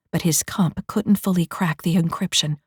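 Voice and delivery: normal talking voice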